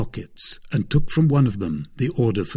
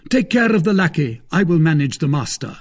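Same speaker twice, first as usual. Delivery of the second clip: raised, high-pitched command; called-out order; 6 semitones higher, 4 dB louder than usual. A narrator's reading. Master orders servant during a fight, called out. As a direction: give brisk orders mid-fight. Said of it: "called out D'Artagnan".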